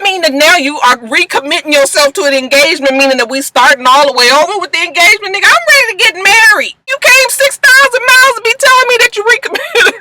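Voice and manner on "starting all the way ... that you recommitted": exasperated rant. Real, not acted.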